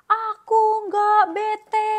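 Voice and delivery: furious gorilla roar of denial; angry shout. Acted. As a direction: bellow it while beating the chest. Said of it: "Jim teriak sambil memukul-mukul dadanya".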